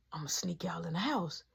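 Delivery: hushed unvoiced whisper. Whispering plan to friends outside. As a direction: keep it down, scheming.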